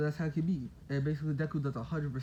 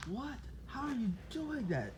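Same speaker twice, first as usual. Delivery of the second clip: much higher and softer, mock amazement; astonished question; 9 semitones higher, 7 dB quieter, slower than usual. Onlookers watch Deku reattach his leg, astonished. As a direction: gasp it out in wonder.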